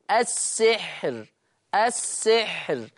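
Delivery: السحر slowly, stopping on a light ra; السحر in pause, ra softened; pausal thin ra. Shown as a demonstration. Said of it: "أما وقفا فهي مرققة للجميع".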